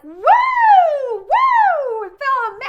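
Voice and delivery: shrieked high, loud mock party whoop; mock cheer. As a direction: squeal it like a fake celebration, cut off mid-word. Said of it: "I'm not like".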